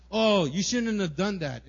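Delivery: drawn-out mock reproach; mock anger. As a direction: act out an open telling-off. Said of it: "it's not like me anger".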